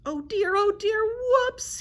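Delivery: loud, high-pitched griffin squawk. A narrator's reading. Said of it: "he squawked".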